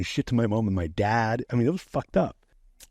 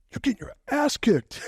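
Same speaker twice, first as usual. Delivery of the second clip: much higher, squeaky mocking voice; mocking jeer. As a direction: jeer at the loser in a high squeak. Bully mocking his friend losing.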